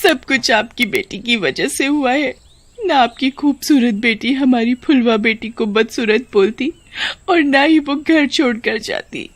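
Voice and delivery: acted weeping accusation down the phone; tearful blaming. A narrator's reading. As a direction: blame her daughter through tears. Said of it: "कोसती है".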